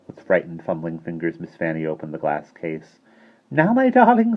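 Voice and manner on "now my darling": put-on a touch of sweet coaxing in a high old-woman voice. A narrator's reading. Tiny shopkeeper turning sweetly to the children, coaxing. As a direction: turn suddenly sweet and welcoming. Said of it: "in quite a different voice".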